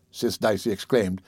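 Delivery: plain reading voice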